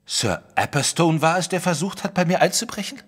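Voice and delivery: high, rising incredulous question; shocked disbelief. Acted. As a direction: gasp it out in disbelief. Wilson shocked by the claim.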